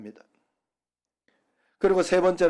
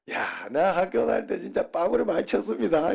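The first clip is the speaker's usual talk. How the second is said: higher, laughing Jeolla pastor quote; quoting through laughter; 5 semitones higher than usual. Retold or imitated. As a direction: retell the pastor's line through giggles. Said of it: "전라도 목사님이 설교하다가". (웃음 섞인 목사님 흉내)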